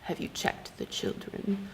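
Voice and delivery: slow, breathy whispered menace from a stalker; creepy caller. Retold or imitated. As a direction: whisper it like the stranger on the phone. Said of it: "and then the guy goes".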